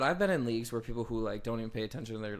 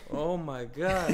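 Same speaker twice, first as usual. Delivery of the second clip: laughing; 5 semitones higher, 3 dB louder, much slower than usual. Real, not acted. Laughing shocked reaction.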